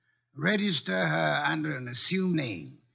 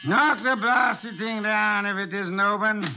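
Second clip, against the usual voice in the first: irritated call; 5 semitones higher, 5 dB louder than usual. Acted. Calling to police at the door.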